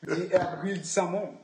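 ordinary talk

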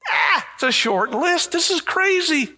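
high-pitched amazed exclamation; incredulous. Real, not acted. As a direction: marvel at how crazy it is. Joking about rejoicing in suffering.

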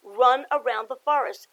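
lively picture-book read-aloud; story narration. A narrator's reading. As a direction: read it to the kids with bounce.

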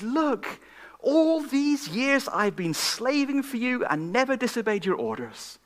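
put-on angry older brother.

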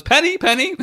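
very high sing-song "Penny, Penny"; high calling golfer. Retold or imitated. Golfer summons Penny again, very high and sing-song. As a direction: call her like a spoiled child. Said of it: "he goes".

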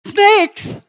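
very high-pitched alarmed squeak; comic high yelp of alarm; retold shock. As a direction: shoot the pitch right up in horror.

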